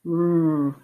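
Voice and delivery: mm as a held "rrr", like in brief; isolated consonant sound. Shown as a demonstration. Teacher naming the sound to delete, mm said as a drawn-out "rrr". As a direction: hold the r sound alone for mm. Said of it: "which sound to delete".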